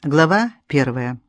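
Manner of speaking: usual speaking voice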